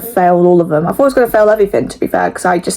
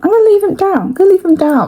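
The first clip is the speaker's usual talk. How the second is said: high, bright snap decision; mock confident resolve; acting out her own decision; decisive self-quote; 9 semitones higher, faster than usual. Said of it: "so I was like".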